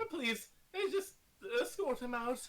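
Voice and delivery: put-on Italian accent. Shopkeeper voice held.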